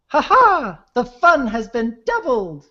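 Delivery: high, booming royal pony voice; Luna. Retold or imitated. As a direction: proclaim it like a princess. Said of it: "a Luna impression".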